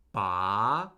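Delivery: Ba rising from a low start; second tone on Ba. Shown as a demonstration. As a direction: let "Ba" climb steadily upward at the end. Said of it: "is a rising tone".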